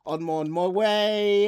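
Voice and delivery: bad Australian accent. Streamer doing the punishment, drawn-out bad Australian accent. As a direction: stretch it out in a poor Australian accent. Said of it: "you're not good at this".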